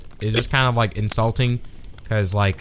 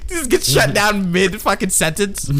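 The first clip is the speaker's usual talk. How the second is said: high, slow, laughing complaint; amused disbelief; 8 semitones higher, slower than usual. Real, not acted. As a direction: exclaim it on the edge of laughter. Joking complaint after being cut off.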